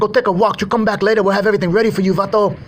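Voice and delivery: high, strained mock thug barking orders; cartel thug voice. Cartel thug orders old man out of car, high mock voice.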